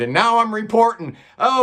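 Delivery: plain reading voice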